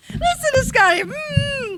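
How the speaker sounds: giggling through the words; laughing. Real, not acted. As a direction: laugh while you say it. Laughing at Scotty's reaction.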